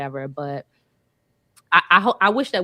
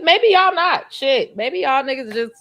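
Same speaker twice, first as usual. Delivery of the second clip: provoking jab; 8 semitones higher, 5 dB louder, slower than usual. Real, not acted. Challenging the panel.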